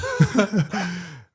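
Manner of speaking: high, loud burst of laughter; laughing. Real, not acted. Player recalling teammates joining in, laughing loudly.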